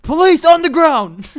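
loud, high, slow police command; hammed-up police order; mock police shout. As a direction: bellow it like a raiding cop. Pretend police raid in game.

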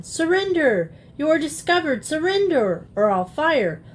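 high ringing shout of a mock hero. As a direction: bellow the surrender like a stage highwayman. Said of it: "shouting out".

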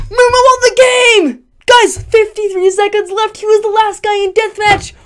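high, loud, slow triumphant yell; excited shout. Real, not acted. Gamer shouting to viewers that Mumu won, overjoyed.

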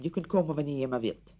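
ordinary talk, nothing marked

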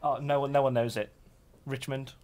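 ordinary talk, nothing marked

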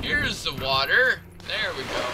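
ordinary delivery

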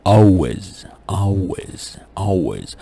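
always said as awways, L turned to w; Cockney always with the L as a w sound; vocalized L. Shown as a demonstration. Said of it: "ينطق واو ساوند".

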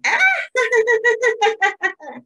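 high, rattling storybook cackle; wicked witch cackle. Shown as a demonstration. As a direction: try out a wicked witch laugh. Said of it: "can you cackle like a wicked witch".

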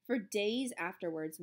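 ordinary delivery, nothing marked

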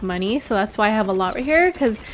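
ordinary delivery

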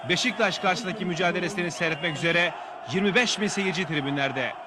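usual speaking voice

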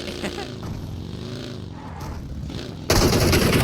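long loud burst of laughter; disbelieving laugh. Real, not acted. Gamer laughing at the enemy's grenade throw, long loud laugh.

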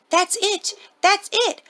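high, loud cry of delight; gleeful shout. Acted. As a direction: shout out the bright idea. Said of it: "I shouted with glee".

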